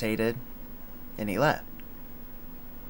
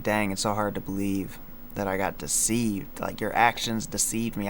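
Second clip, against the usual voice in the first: normal talking voice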